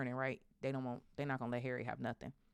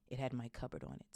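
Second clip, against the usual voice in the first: ordinary delivery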